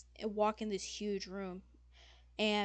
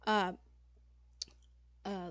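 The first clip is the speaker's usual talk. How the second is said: ordinary talk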